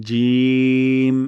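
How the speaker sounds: জিম held long and loud; জিম sustained at full voice; drawn-out jim. Shown as a demonstration. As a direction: stretch জিম out slowly. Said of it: "জিহ্বার মধ্যখান".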